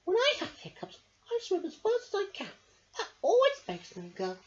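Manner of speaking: high, quick fish voice; lightly voiced Angel Fish. A narrator's reading. Angel Fish explaining her hiccup cure, high voice. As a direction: rattle off the tip brightly.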